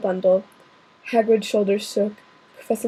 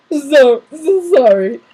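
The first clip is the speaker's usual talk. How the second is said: a loud, wailing apology from the giant; blubbering sorry; big man bawling; sobbing; 4 semitones higher, 9 dB louder, slower than usual. Acted. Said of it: "sobbed Hagrid".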